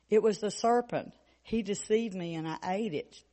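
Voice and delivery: ordinary talk, nothing marked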